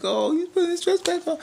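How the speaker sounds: high campy mocking falsetto; mock falsetto tease. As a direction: squeal it like a gossiping auntie.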